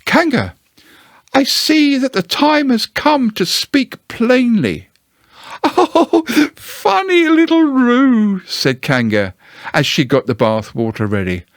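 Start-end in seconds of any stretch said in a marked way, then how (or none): 0.0-4.9 high, slow, would-be brave Piglet. Acted. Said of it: "in as brave a voice as he could". 5.6-8.4 high, slow doting coo; Kanga's coo. Acted. Kanga cooing at Piglet.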